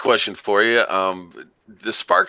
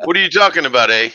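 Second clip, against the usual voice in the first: put-on joking Canadian accent, higher and louder, leaning on the eh; mock Canadian accent; 6 semitones higher, 6 dB louder than usual. Answering the Canadian accent joke in mock Canadian accent. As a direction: tease them in a hammed-up Canadian accent. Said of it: "that Canadian accent".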